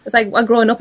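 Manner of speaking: ordinary delivery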